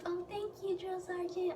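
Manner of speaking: high, very soft mock thanks; sweetly grateful. Girl thanks sergeant for picking up bag, sweetly grateful. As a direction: imitate a meek, grateful recruit.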